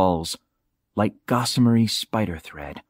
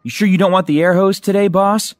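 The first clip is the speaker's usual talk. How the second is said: put-on higher, fast, eager young diver asking his boss; young diving partner; 9 semitones higher, 4 dB louder than usual. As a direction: voice Tommy up high and quick, keen to help. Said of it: "Tommy asked".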